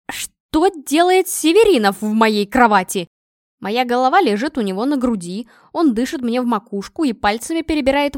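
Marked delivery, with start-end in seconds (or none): 0.1-3.1 loud, slow, stressed disbelief; startled question, hit each word hard; shocked. A narrator's reading. Girl wakes to find him in her bed, shocked.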